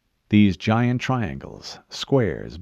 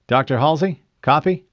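higher, louder, rising call; urgent repeat; 6 semitones higher, 4 dB louder than usual. A narrator's reading. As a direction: press her for an answer. Wasn't responding.

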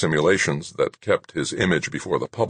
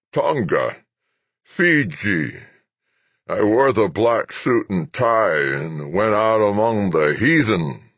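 slow, higher frail reminiscence; nostalgic old man; 5 semitones higher, 3 dB louder, slower than usual. Acted. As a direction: savour the memory slowly. Old general reminisces, wipes eyes.

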